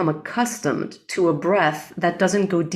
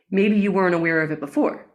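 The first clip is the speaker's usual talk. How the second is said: usual speaking voice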